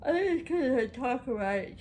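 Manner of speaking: words stretched by a yawn. Real, not acted. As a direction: talk through a big yawn. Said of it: "I'm sorry".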